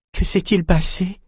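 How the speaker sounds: high, frightened trembling voice; quavering with fear. Acted. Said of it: "d'une voix tremblante".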